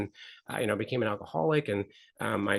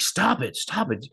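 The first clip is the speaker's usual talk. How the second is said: mock angry kid, higher at first; getting angry; 5 semitones higher, slower than usual. Son snapping at dad who keeps pelting him, getting angry. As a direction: blurt it out as an irritated child. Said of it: "he's getting angry".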